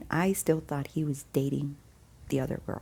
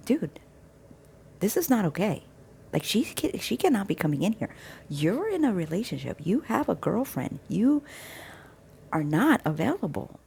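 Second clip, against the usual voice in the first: higher-pitched, emphatic scolding; telling him off; 4 semitones higher than usual. Retold or imitated. Woman telling the boss man his ex cannot visit, scolding. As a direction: lay down the law to a friend.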